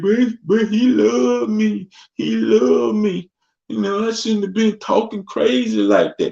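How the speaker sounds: high, slow whiny woman's voice; hammed-up high plaintive excuse; mock abused woman. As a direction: whine it up high like a smitten victim. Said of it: "And she's like".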